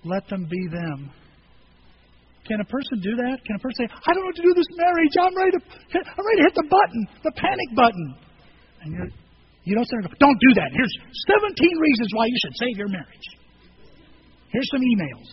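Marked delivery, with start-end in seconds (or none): 3.9-8.2 high, loud, agitated venting; hammed-up desperate outburst, easing at the end; acted meltdown. Voices person melting down over marriage. 10.2-15.3 loud jump-in correction, easing off; lightly acted pushy fixer; mock bossy advice. Mimics pushy advice-giver.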